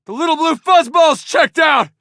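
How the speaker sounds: loud, high casualty call; urgent battle shout. Acted. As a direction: yell it across the battlefield. Reporting casualty mid-battle.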